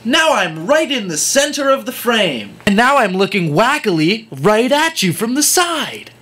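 high, bouncy, wacky vlogger voice; mock vlogger cheer. Parodying vloggers who jump around the room, high and wacky. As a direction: play it wacky and over-cheery. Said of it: "just do cut edits so you magically appear".

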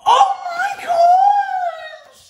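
very high, slow, loud squeal; thrilled squeal at an unexpected gift; squealing delight. Real, not acted. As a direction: shriek oh my gosh, overjoyed. Gasps at the Golden Girls gift.

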